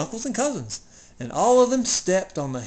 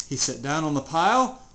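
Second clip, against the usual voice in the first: usual speaking voice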